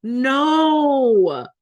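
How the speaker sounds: snotty crying. Real, not acted. Crying at the elf house.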